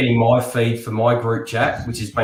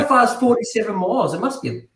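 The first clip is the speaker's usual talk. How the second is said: exasperated; 8 semitones higher than usual. Retold or imitated. Continued exasperated driver quote.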